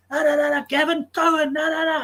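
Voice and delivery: high, loud excited denial of a storyteller; hammed-up retold voice of the wild character; imitating the character. The character telling Gavin about his mates, imitated high and loud.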